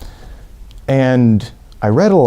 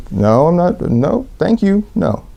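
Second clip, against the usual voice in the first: high, pleading no thank you; politely wriggle out of the drink; polite refusal; 8 semitones higher than usual. Retold or imitated. Turning down the man's vodka, high polite pleading.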